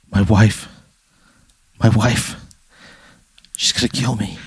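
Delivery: mild panicked pleading; half-hearted scared whimper. Acted. Captured man pleading to himself about wife, mild panic. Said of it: "He's, like, struggling".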